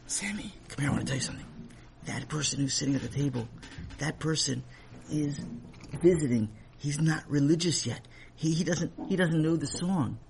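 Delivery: soft, half-whispered explanation; quiet confiding father; hushed aside. A narrator's reading. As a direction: tell him quietly so the guest won't hear. Father tells Sammy quietly.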